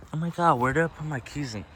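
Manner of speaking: high, fast and flustered; frantic search for lost keys. Real, not acted. Can't find car keys.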